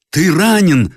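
high, loud cry of alarm. A narrator's reading. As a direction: cry out that he is hit. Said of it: "вскричал кавалерист". (вскрик тревоги)

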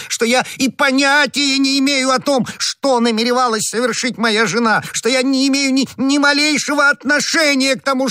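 very high, wailing oath; frantic pleading. Acted. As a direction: swear desperately at the top of your pitch, drawing out the final curse. Terrified husband disowns his wife.

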